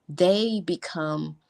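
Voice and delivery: normal talking voice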